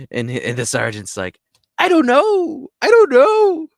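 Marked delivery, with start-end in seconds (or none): 1.8-2.7 high, loud, strained falsetto sergeant; panicked sergeant. Retold or imitated. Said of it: "You know, just like that". 2.8-3.7 even higher and louder squealing falsetto; panicked sergeant. Retold or imitated. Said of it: "You know, just like that".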